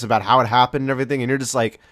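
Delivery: ordinary talk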